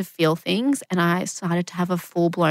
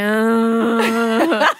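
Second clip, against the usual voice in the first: cow-like groan; 3 semitones higher, 5 dB louder than usual. Retold or imitated. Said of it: "make all these weird noises that I couldn't control, like a cow".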